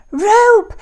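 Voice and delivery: loud, high excited cry. A narrator's reading. Jack crying out to his mom as he lands. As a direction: shout it as the idea strikes. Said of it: "cried Jack".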